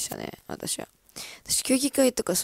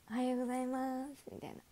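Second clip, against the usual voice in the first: stiff timid greeting; 4 semitones higher, 8 dB quieter than usual. Retold or imitated.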